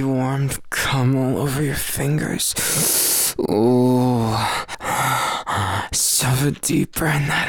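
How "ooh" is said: long, loud "ooh" moan; drawn-out moan. Acted. Lover reacting to partner, long moan. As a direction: moan it out on one held note. Moaning during intimate roleplay.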